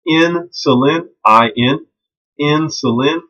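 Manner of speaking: "Insulin" slowly split, IN drawn out and pitched high; stress on IN. Shown as a demonstration. Teacher helping students who mix these up, "Insulin" with emphasized IN. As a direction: spell out "I N" and lean on the IN of "insulin".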